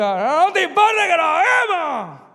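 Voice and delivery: loud, high panicked scream; the shout. Acted. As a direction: scream it over the waves. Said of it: "después de ese grito".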